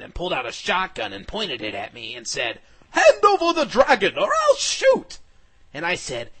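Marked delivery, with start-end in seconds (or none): 2.9-5.2 high, loud villain's shout; menacing ringmaster. Acted. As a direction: bellow the threat down the barrel. Ringmaster threatens with shotgun.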